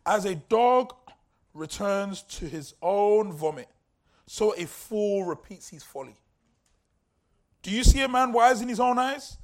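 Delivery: loud, slow scripture proclaimed to the church; preaching scripture reading. Acted. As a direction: proclaim the verse slowly and loud. Reads scripture aloud.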